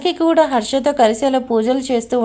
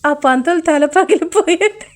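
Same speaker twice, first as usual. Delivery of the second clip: laughing retort; 4 semitones higher, slower than usual. Acted.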